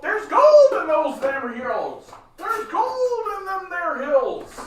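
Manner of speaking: very high, drawn-out prospector cry; hammed-up old-time gold-rush holler. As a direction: stretch it out in a piercing caricature. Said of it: "the first guys who came in".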